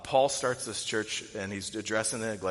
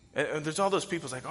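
usual speaking voice

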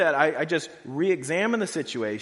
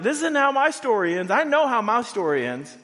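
much higher and louder, ringing certainty; triumphant Paul; 7 semitones higher, 6 dB louder than usual. Retold or imitated. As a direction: proclaim it like victory is already won. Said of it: "Paul knows, like".

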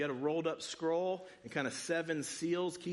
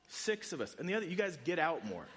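ordinary delivery

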